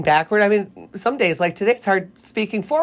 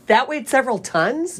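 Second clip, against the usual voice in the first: surprised; 7 semitones higher than usual. Real, not acted. Disbelief at the fact.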